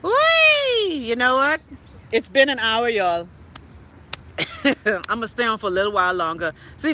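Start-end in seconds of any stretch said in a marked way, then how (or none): 0.0-0.9 a very high gleeful whee; high squeal whee. Real, not acted. Celebrating an hour live to viewers, a very high gleeful squeal. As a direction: let out a squeal of glee.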